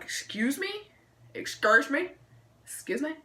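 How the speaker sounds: high, rising repeated question; retold offence, lifting each one into a question; affronted disbelief. Acted shock at being accused.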